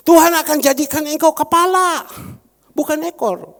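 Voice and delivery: loud, ringing pulpit proclamation; a pastor booming scripture at his congregation; preaching at full force. Acted. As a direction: thunder God's promise to the church. Preaching God's word loudly.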